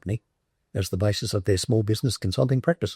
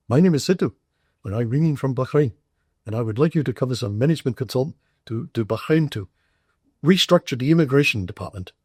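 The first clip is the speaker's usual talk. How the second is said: higher, imitated Indian-accented phone voice; 4 semitones higher than usual. Caller offering a job in Bahrain, imitated Indian accent. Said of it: "this Indian voice said".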